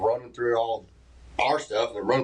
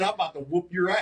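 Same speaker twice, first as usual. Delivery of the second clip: threat repeated through laughter, higher; laughing quote; 7 semitones higher than usual. Retold or imitated. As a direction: laugh while quoting the threat. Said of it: "Mike said".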